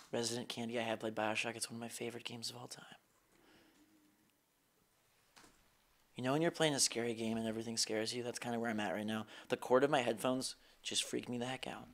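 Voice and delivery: quietly chatting, still spooked; hushed. Real, not acted. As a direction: keep it low after the scare. Keeps voice low after scare.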